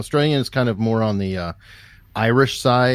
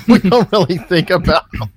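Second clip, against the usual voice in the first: high-pitched, louder, through a laugh; 9 semitones higher, 7 dB louder than usual. Real, not acted. Host teasing guest about the Canada rivalry, laughing. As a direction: let the laugh carry into the words. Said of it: "makes me laugh".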